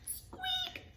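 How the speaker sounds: tiny high squeak; a very high mouse squeak. Acted. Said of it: "said Mouse".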